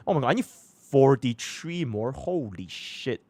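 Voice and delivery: slow, drawn-out dismay; slow dismayed disbelief. Real, not acted. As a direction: groan it out in disbelief. Dismay at forty-three more.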